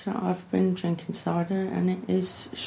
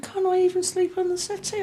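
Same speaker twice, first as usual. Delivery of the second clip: much higher mock plea; imitating pleading boyfriend; 12 semitones higher than usual. Woman imitating her boyfriend begging to stay, mock whine. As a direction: mimic his whiny begging. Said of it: "I said".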